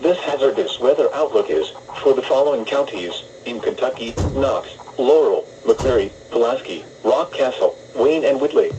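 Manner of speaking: usual speaking voice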